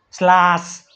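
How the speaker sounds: સ્લાસ starting SL in place of KL; misreading with S. Shown as a demonstration. Teacher quotes someone's misreading સ્લાસ, with SL. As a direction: misread class as સ્લાસ, S before the L. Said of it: "કોઈએ વાંચ્યું?".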